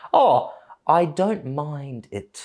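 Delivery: ordinary delivery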